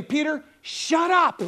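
slow, loud, high put-down; comically blunt shushing; mock exasperated command. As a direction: stretch it out to silence an interrupter. God bluntly silencing Peter, paraphrased.

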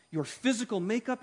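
ordinary talk, nothing marked

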